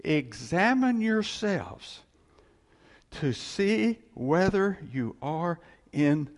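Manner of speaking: slow scripture reading. Shown as a demonstration. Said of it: "Second Corinthians thirteen five".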